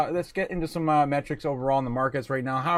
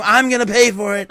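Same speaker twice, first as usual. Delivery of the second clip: louder mock Trump boast; 5 semitones higher, 7 dB louder than usual. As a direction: puff it up like Trump bragging. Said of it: "saying Trump things".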